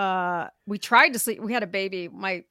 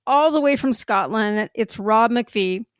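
podcast intro read; 7 dB louder than usual. Acted. Reads the guest intro.

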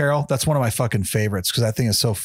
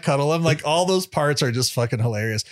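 usual speaking voice

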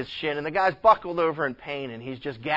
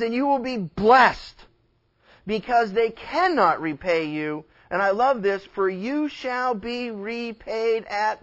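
emphatic preaching, slowing on the verse; 7 semitones higher, 6 dB louder than usual. Real, not acted. Preacher urging the church to give to the poor, preaching. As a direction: weigh out the scripture, then urge them on.

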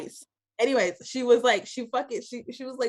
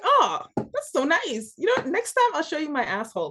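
sarcastic; 8 semitones higher than usual. Retold or imitated. Cheeky retort to nurse.

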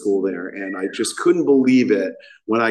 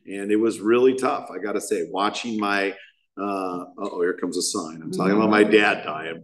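ordinary delivery

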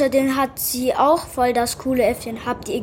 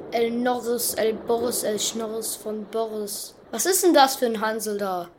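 usual speaking voice

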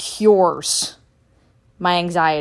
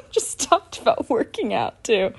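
laughing; 4 semitones higher, 3 dB quieter than usual. Real, not acted.

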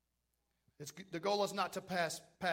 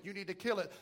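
usual speaking voice

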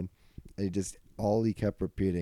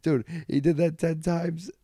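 laughing; 9 semitones higher, 5 dB louder than usual. Real, not acted.

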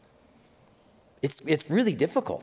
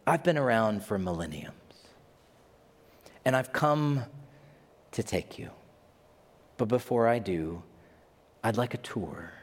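voice of death. Retold or imitated. Voice of death speaks.

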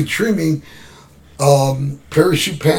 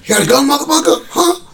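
higher, fast, aggressive demand; menacing challenge; 10 semitones higher than usual. Retold or imitated. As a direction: push him, fast and threatening. Attacker confronting them.

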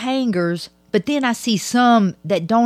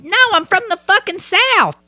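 exasperated outburst; 10 semitones higher than usual. Real, not acted. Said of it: "do people think I'm from fucking Boston".

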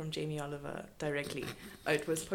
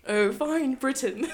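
mock British laughing; 7 semitones higher, 5 dB louder, slower than usual. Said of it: "Margaret Thatcher's accent".

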